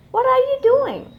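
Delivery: put-on high, loud child's call across the gate. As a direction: shout the question up to the teacher. Child calls to teacher.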